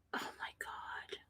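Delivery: breathless whispered aside. Real, not acted. Whispered aside about stepfather.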